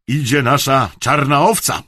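loud announcement to the room; called out. A narrator's reading. Dad announcing his brother's arrival, calling out. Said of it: "zawołał tata".

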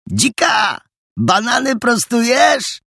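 wysoki, wolny, zaczepny okrzyk; zawołaj przeciągle i wyżej, na zaczepkę; wołanie. A narrator's reading. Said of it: "wołał Adaś". (zaczepne, przeciągłe wołanie Adasia)

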